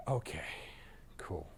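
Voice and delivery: softly whispered, shy and agreeable; hushed, hesitant acceptance; timid whisper. Retold or imitated. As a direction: breathe it out like a cautious client warming up. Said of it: "The S is like".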